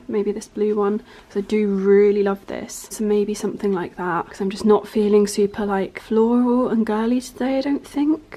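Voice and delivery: ordinary talk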